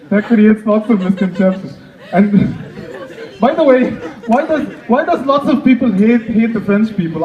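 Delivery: ordinary delivery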